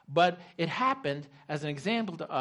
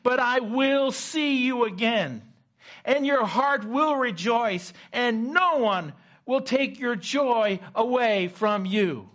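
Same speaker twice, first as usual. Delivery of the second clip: slow, loud, high proclamation; retold triumphant scripture shouted down the phone; almost shouting; 8 semitones higher, 7 dB louder, slower than usual. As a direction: declare each phrase forcefully, stretched out. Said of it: "she almost shouted it at me in the phone".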